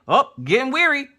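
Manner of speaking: high-pitched mock exclamation; lightly voiced, high little quip; high and comic; personified metabolism. Acts out her metabolism slowing.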